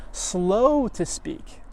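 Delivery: stretched very slowly on purpose; drawn out. Shown as a demonstration. Teacher quoting scripture, slowing right down on slow to speak. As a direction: act out the slowness as you say it.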